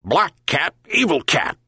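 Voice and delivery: high yelping dog voice, lightly voiced; a quick yelp of accusation. Dog yelps at the cat, light high yelp. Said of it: "yelped the dog".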